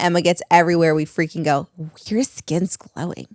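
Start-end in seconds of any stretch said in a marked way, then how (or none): 2.1-3.3 slow, soft sing-song imitation of a fan; mock compliment. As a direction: drawl the compliment out like a gushing stranger. Mocking common compliment.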